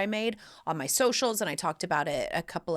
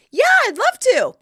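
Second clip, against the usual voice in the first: much higher and louder, gushing thanks; eager yes; 13 dB louder than usual. Retold or imitated. Shivering guest gladly accepts Janet's sweatshirt, loud and high. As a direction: jump at the offer. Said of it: "I was like".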